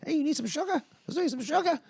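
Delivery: high-pitched, creepy puppet pusher; creepy puppet dealer. Acted. Puppets selling sugar like drugs, creepy high voice. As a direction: peddle it in a high puppet squeak. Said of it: "Weird, creepy fucking puppets selling drugs".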